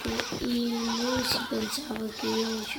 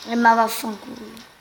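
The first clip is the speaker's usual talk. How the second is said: sudden angry shout; loud cursing; 2 semitones higher, 11 dB louder than usual. Real, not acted. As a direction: blurt the curse out loud. Opponent's play, swearing.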